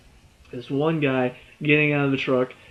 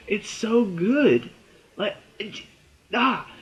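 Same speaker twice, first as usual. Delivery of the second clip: slow, stretched, higher "It's so good"; fangirling, stretched and higher; swooning gush; 6 semitones higher, slower than usual. Real, not acted. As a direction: melt into "It's so good" even more. Gushing over the show.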